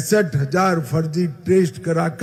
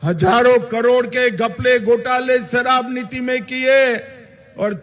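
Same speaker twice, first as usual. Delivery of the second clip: booming political rally speech; 8 semitones higher than usual. Acted. Rally speech raised high.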